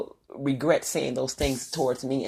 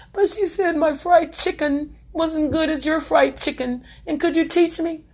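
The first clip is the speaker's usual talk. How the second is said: high, pleading voice of a tearful neighbour; upset lady on the phone; 11 semitones higher, 3 dB louder than usual. Retold or imitated. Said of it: "The lady called her crying".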